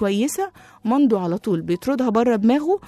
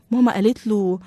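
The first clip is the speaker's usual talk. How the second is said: usual speaking voice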